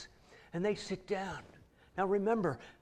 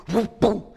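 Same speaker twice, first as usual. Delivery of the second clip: louder playful whoop-boom splat; tomato throw noise; 10 dB louder than usual. Retold or imitated. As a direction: fire back the tomato with a loud splat noise. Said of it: "he takes a tomato".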